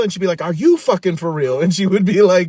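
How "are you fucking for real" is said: loud, higher imitation of a furious mom; retold angry disbelief. Mom firing back at her kid, loud imitated outrage. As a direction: fire it back, mock-outraged.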